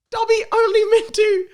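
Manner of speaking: very high squeaky house-elf voice; Dobby impression. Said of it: "Finito this quotronum".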